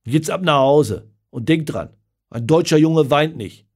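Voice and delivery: loud, hard, cold order; harsh command. Acted. Father sending his son home after a slap, harsh. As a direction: bark the rule at him.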